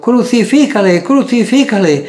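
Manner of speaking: high, loud repeated cry; crowd shouting. A narrator's reading. Crowd shouting at Pilate to crucify him, loud high cry. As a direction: hurl it out like a crowd demanding death. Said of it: "volvieron a dar voces diciendo".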